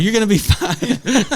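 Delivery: high, loud, drawn-out reassurance through laughter; mock reassurance. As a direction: laughingly imitate staff calming a child. Staff reassuring panicking boy, amused retelling.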